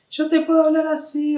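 very high, held monotone voice; high pitch. Shown as a demonstration. Voice coach demonstrating an acute tone, very high voice. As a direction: pitch it way up on one note. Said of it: "El tono es agudo o grave".

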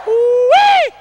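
a high, loud whoop; loud delighted yelp; excited holler. Acted. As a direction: whoop it up at the flashy arrivals. Hollering at pimps arriving.

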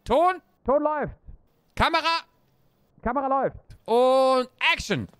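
bossy director calling the shots; film director. Pretend. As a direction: bark orders like a film director.

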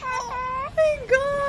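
high, slow, drawn-out oh my God; stunned. Real, not acted. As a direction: let it stretch out high in disbelief. Shocked by the video clip.

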